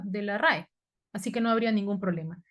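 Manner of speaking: ordinary talk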